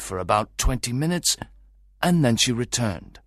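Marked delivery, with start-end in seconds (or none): none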